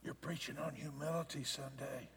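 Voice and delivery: very soft, imitated divine prompting; near-whispered quote, dropped far below preaching volume; hushed voice of the Lord. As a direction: say it as a quiet inner voice, barely aloud. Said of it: "the Lord said".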